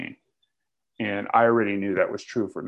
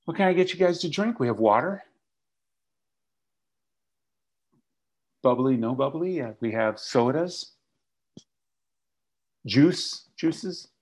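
waiter voice; 5 semitones higher than usual. Retold or imitated. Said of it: "He says".

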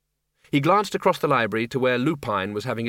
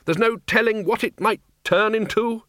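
shouting; 9 semitones higher than usual. Acted. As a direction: keep yelling above the clatter. Ridcully keeps shouting over noise.

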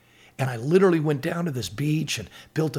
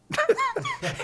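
burst of laughter. Real, not acted. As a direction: laugh out at the punchline. Laughing at pastor's joke.

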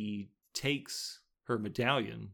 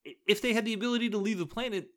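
incredulous; 10 semitones higher than usual. Real, not acted. Incredulous rant about movie logic.

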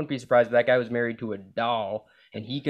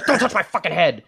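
loud, higher imitation of a telling-off; mock angry yell; 5 semitones higher, 6 dB louder than usual. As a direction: snap it like an offended date. Retold angry order, amid laughing.

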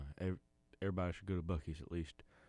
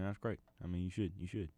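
ordinary talk, nothing marked

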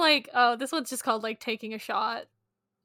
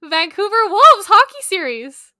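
excited; 9 semitones higher, 17 dB louder than usual. Real, not acted. Reading a silly book title.

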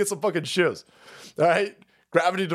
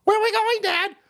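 put-on kid voice. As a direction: play the confused child being dragged off. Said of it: "And I would be like".

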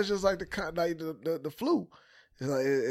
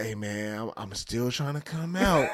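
lower, softer and slow; making excuses not to go out, softly and slowly; mock weak excuse; 5 semitones lower, 3 dB quieter than usual. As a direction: put on a feeble, sorry-for-myself voice. Retold excuse to his friend.

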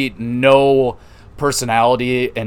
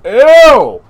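disgusted ew; 12 semitones higher, 12 dB louder than usual. Real, not acted. Reacting to backstage flirting.

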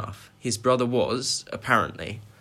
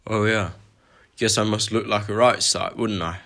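chuckling, good-natured giant; a big man laughing at himself a little; Hagrid's voice; 4 dB louder than usual. Acted.

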